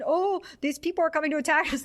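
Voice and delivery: lightly acted panic; mock alarm. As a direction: imitate an alarmed report to the captain. Retold men alarmed, warning captain.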